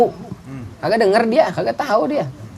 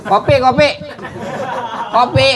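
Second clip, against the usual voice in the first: demanding husband; 10 semitones higher than usual. Retold or imitated. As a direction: bark out an order for "kopi" from the sofa. Lazy husband demands coffee. (suami malas minta kopi dengan suara tinggi dan keras)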